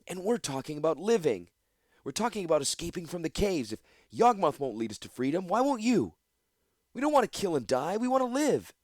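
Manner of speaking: high, desperate plea; pleading young man. Acted. Young man pleads with Gix for escape. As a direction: beg to live, not fight.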